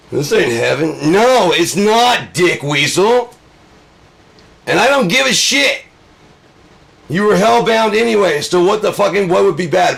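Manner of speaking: high, loud shouted rebuke; shouted acted tirade, high-pitched and forceful; angry shouting. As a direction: yell it furiously at a condemned sinner. Acted furious rebuke of a sinner.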